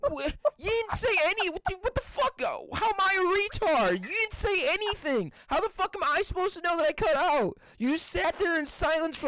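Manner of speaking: exasperated, pitch riding high; indignant. Real, not acted. Blaming a friend for staying silent, indignant. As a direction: protest in high-pitched disbelief. Heated protest over a dropped call.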